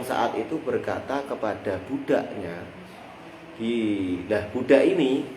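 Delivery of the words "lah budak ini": normal talking voice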